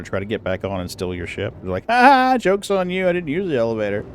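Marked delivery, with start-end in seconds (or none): 1.9-4.0 mock taunt. Player taunting ship thieves at the elevator, gloating mock laugh. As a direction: rub it in to the would-be thieves.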